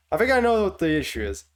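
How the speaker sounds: ordinary talk, nothing marked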